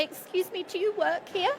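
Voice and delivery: timid stranger unsure who to ask; hesitant customer question. Retold or imitated. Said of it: "they're not asking".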